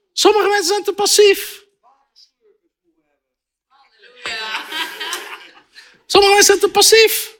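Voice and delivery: high-pitched, challenging preacher; fervent preaching. Real, not acted. Preacher confronting overly passive Dutch believers, fervent.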